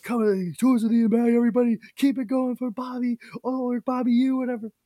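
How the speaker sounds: high-pitched MC calling up an act; club host intro. Retold or imitated. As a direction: imitate a flustered host's stage introduction. Imitates host bringing on next comic.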